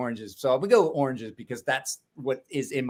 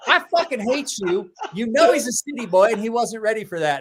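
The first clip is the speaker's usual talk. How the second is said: raised, higher angry scold; a mock furious scold; 5 semitones higher, 4 dB louder than usual. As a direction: scold him, half joking, pitched up. Woman scolding narrator.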